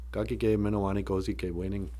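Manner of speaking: soft Ojibwe phrase in one run. Shown as a demonstration. Speaker giving the whole Ojibwe phrase, softly fluent. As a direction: run it together softly, without pauses.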